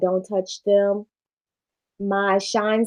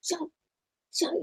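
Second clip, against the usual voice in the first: hushed shut up; 8 semitones higher, 12 dB quieter, much faster than usual. Retold or imitated. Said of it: "you stand in the aisle like".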